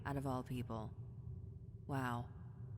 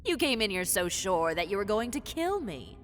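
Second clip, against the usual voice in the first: loud mockery; 8 dB louder than usual. Loud mocking.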